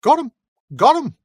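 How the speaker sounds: high eager hobbit shout; excited cry. Acted. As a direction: blurt it out eagerly to the orc. Hobbit answers the orc.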